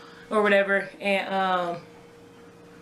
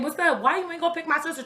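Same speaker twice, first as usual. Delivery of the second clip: raised, hurried mock scolding; retold telling-off, pitched up; going in on him; 8 semitones higher, faster than usual. Fiancée confronting her man over the missed pickup, mock scolding. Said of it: "Trying to go in on him".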